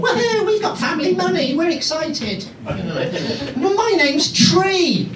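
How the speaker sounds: high, giddy airhead voice mocking the young; mock young contestant. Comic mocking dim young game show contestants, high giddy voice. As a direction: ham up a vapid excited teen.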